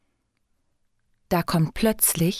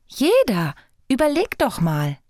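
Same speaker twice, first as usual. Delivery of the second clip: high, emphatic fairy voice; coaxing insistence, higher voice; insistent urging; 6 semitones higher than usual. A narrator's reading. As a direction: press the point, pitched up. Fairy urges the heart to think.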